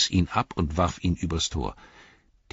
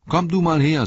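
loud, much higher summons; commanding call; 12 semitones higher, 7 dB louder than usual. Acted. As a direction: call him over sharply. Merry summons a hobbit.

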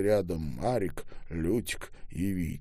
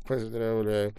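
ordinary delivery

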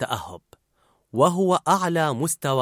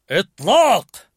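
loud, higher fire order; officer's command; 6 semitones higher, 8 dB louder, slower than usual. A narrator's reading. Dad's Anger ordering fire like an officer. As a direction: bark it like an officer. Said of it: "كالضابط".

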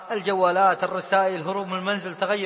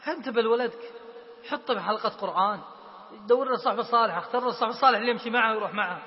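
ordinary talk

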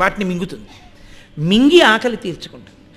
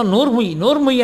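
usual speaking voice

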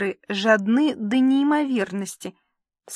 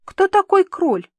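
high, louder puzzled question; curious question; 10 semitones higher, 6 dB louder than usual. Acted. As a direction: ask it with real curiosity. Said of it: "поинтересовался Иван".

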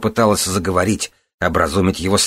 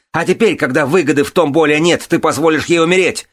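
very high, heated accusation; enraged yell; 10 semitones higher, 4 dB louder than usual. A narrator's reading. Son accusing the lady of abandoning his mother, yelling. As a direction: pour out outrage in a raised voice. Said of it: "заорал он".

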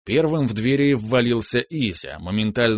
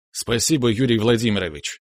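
usual speaking voice

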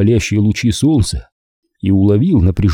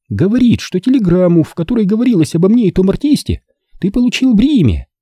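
put-on high young woman, pleading and irritated; молодая женщина Корола; 10 semitones higher than usual. A narrator's reading. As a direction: voice her higher, pressing him to leave. Corola explains to Maldi. (высокий женский голос, с упрёком)

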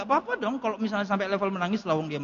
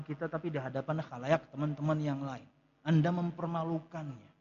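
"Anda mempermalukannya" said softer and lower; quiet restatement; 5 semitones lower, 9 dB quieter than usual. Shown as a demonstration. Preacher repeating his warning gently to listeners, quiet. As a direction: let "Anda" lead and drop the rest to a soft close. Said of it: "Jangan ya, jangan nasihati".

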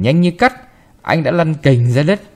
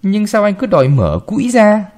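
sulky, higher-pitched grumble; sulky reply; 7 semitones higher, 3 dB louder than usual. A narrator's reading. Maid girl sulking back at the boy, higher and sulky. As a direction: mutter back resentfully. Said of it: "dấm dẳn nói".

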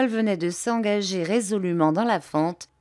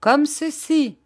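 bold reply; 10 semitones higher, 5 dB louder, slower than usual. A narrator's reading. Hassel answers, about to jump.

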